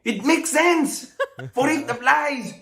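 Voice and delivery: high, laughing, smug; Spanish smugly defending its phrase; laughing insistence. Acted. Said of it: "Spanish, no".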